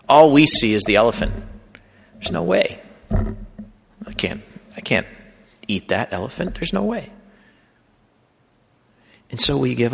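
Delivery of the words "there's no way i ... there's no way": despairing. Pretend. Voicing despair before sin.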